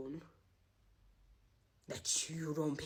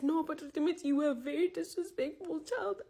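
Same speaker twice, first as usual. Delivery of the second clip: mock crying mom; 9 semitones higher, 3 dB louder than usual. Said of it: "she started crying".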